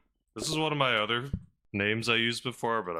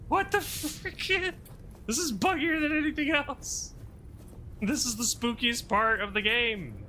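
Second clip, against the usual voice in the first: spooked squealing. Real, not acted. Spooked in buggy area.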